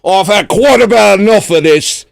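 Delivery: light Australian host scolding his guest; a hint of an Australian scold; Australian accent. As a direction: play the offended Aussie TV host. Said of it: "That's Australian".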